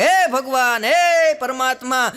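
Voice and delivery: loud, high, slow devotional plea; mock prayer. Wife praying to God about her husband, loud slow plea. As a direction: call on God like a pious wife. પ્રાર્થના કરતા હતા.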